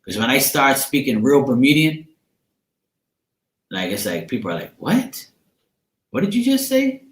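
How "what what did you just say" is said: high, baffled squeak of confusion; baffled what. Retold or imitated. People baffled by her Bermudian slang, squeaking high and confused.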